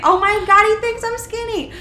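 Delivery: high, loud giddy squeal; excited thrill. Retold or imitated. Thrilled that her friend noticed her weight, giddy high squeal. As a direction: gush like a thrilled teen. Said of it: "You were excited".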